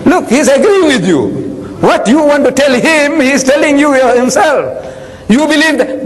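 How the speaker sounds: usual speaking voice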